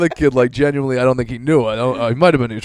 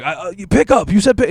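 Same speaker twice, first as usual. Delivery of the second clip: baffled clerk pitched way up, louder; mock confused clerk; 9 semitones higher, 6 dB louder than usual. The clerk confused by the prank caller, high and louder. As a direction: play the clerk losing patience, high. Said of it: "they'd be like".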